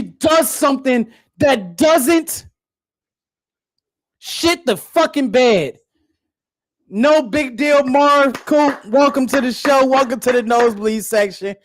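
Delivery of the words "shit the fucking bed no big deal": ordinary talk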